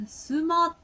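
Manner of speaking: smart as drawn-out "sumaato"; Japanese pronunciation. Shown as a demonstration. Contrasting what they say with English, in Japanese pronunciation. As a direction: say smart with Japanese vowels, "su-maa-to". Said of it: "When they say".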